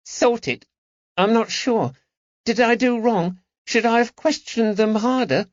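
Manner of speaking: put-on high, anxious boy's voice; the lad worried he did wrong; unsure boy. A narrator's reading.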